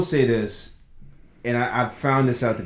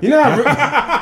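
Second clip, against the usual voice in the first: laughing; 11 semitones higher, 5 dB louder than usual. Real, not acted. Laughing while telling story.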